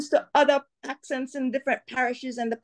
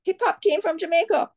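passionate insistence; insistent; 7 semitones higher than usual. Real, not acted. Heated insistence on origins.

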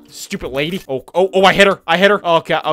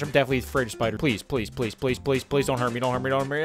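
low, soft begging; timid, quiet plea; hushed pleading; 5 semitones lower, 6 dB quieter, faster than usual. Real, not acted. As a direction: murmur so the spider won't attack. Sneaking past feared spider, pleading.

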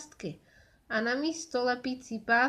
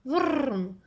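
rolled, voiced "vrrm" of an engine; engine noise; 3 semitones higher, 3 dB louder than usual. A narrator's reading. Narrator sets the rescue vehicles off, playful engine noise. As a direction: imitate an engine revving up.

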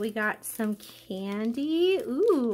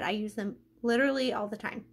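ordinary talk, nothing marked

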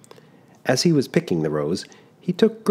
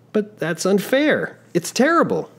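put-on shocked young traveler; 14 semitones higher than usual. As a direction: object in disbelief. Giovannino objects.

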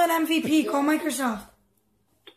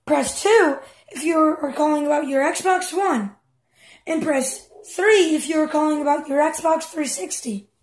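slow, evenly paced automated menu voice; phone menu recording; slower than usual. Retold or imitated. Mimicking Microsoft's phone menu to mock the caller, slow automated read. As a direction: spell out each option like a phone robot. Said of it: "And then they say".

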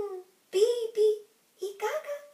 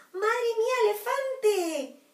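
1 desperate puppy; 6 semitones higher, 5 dB louder, slower than usual. Acted. Puppy despairing at elephant.

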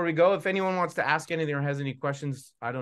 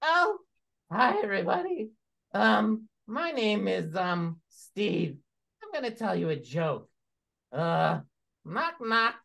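puppet voice. Acted. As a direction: play the puppet Steve telling a knock-knock joke. Puppet show, Steve puppet character.

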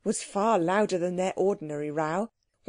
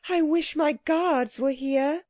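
high, slow regal cry; queen crying out; 7 semitones higher than usual. A narrator's reading. The Queen wishes for her guards, high imperious cry. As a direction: call it out high like a grand queen. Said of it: "cried the Queen".

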